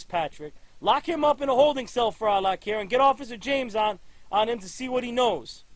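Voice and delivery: loud angry barked commands; furious orders. Acted. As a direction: throw him out in a rage. Chief orders officer out.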